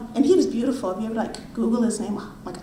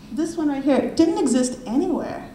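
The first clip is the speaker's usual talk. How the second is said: ordinary talk, nothing marked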